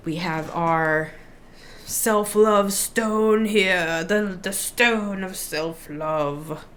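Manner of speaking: put-on slow, drawn-out British accent; unplanned slide into a British accent; a light British accent on the stone; slipping into British. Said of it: "I always go British".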